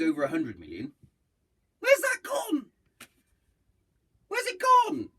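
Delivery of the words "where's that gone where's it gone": indignant exclamation. Real, not acted. Outrage over charity money.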